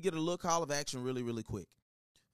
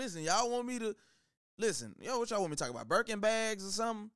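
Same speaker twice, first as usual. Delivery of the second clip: ordinary delivery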